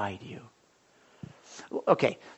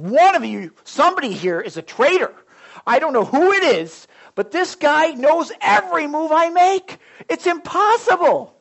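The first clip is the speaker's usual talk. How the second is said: higher and louder, rising to a near yell; furious king; 10 semitones higher, 6 dB louder than usual. Retold or imitated. King of Aram to his generals, rising fury. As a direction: rage at the hidden traitor. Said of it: "He said".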